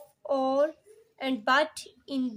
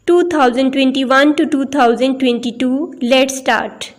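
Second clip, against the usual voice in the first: loud, measured announcer-style opening; teacher opening her video lesson, loud and formal; formal lecture intro; 16 dB louder, much slower than usual. Acted.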